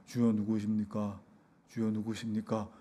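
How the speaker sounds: low, hushed, monotone mumble; mumbling. Retold or imitated. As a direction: mumble it under your breath, imitating a murmured plea. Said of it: "웅얼웅얼하면서".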